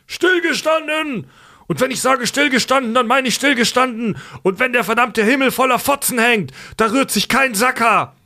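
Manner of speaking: high, yelling drill sergeant; barked drill commands. Retold or imitated. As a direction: bark it like a raging drill sergeant. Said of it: "brüllt wie von Sinnen".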